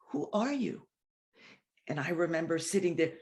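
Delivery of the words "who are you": soft and sweet, a little higher; hushed, sweet question; sweet, quiet voice. Retold or imitated. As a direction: ask it gently like a tender inner voice. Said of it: "very, very sweetly, very quietly".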